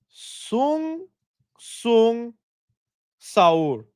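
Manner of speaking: slow, separated words with pauses between; sounding out. Shown as a demonstration. Streamer showing viewers a brain rot name, slowly sounded out.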